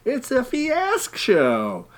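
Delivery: high, slow show-title call; announcer sing-song. Pretend. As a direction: sing out the show name like a jingle. Show intro jingle voice.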